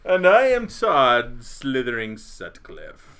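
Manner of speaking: slow, loud spooky host voice; horror host. Acted. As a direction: ham up a sinister introduction. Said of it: "dispense with the craziness".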